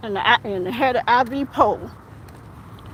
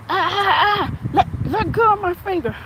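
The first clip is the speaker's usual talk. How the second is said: retold pained cry; 7 semitones higher than usual. Finger being bitten.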